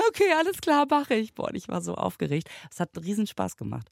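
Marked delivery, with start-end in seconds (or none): 0.1-1.3 high, louder, bright eager agreement; eager yes. Retold or imitated. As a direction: jump at the chance with a quick yes.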